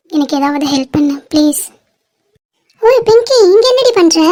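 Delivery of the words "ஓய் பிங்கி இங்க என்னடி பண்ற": calling out. Acted. Friend calls out to Pinky.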